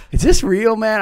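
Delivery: high, loud doubting customer; suspicious customer. Retold or imitated. Parking-lot customer doubting the kid, high and loud. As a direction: ask it like a wary stranger in a parking lot. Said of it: "And I'd be like".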